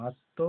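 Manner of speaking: আত্ম as "atto", no ম sound; stressed ত, silent ম. Shown as a demonstration. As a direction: press a double ত in আত্ম. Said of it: "তটা জোর দিয়ে বলা হবে".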